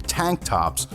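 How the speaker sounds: ordinary talk, nothing marked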